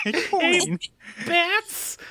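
a giggly Harley Quinn squeal. Acted. Answering the Harley Quinn joke in a high mock Harley voice. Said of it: "you sounded like Harley Quinn".

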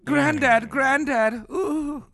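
high breathy swoon; swooning flirt. Pretend. Mock swooning.

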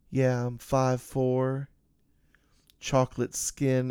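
lightly voiced woman on the phone, slow; drawn-out, casual phone answer.

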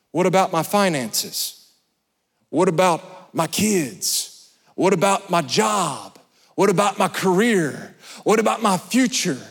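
usual speaking voice

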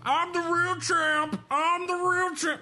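high, slow, far-off megaphone shout; megaphone Covington. Retold or imitated. Covington at Usman's open workout, high slow megaphone blare. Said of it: "with a megaphone and was like".

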